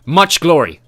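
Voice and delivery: loud firm agreement; firm confirmation. A narrator's reading. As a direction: confirm it forcefully. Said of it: "Roran confirmed".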